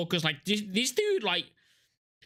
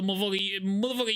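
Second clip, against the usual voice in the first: ordinary talk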